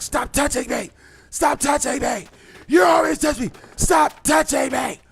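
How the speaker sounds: high-pitched outraged mock shout; mock yelling. Woman at a bar accusing him for everyone to hear, mock yell. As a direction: yell it as a mocking imitation of an accuser. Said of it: "after she yells out this goofy shit".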